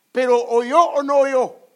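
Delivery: slow, loud, ringing question; loud preaching challenge. Real, not acted. Preacher booming a slow question at the congregation, loud. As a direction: thunder the question at them.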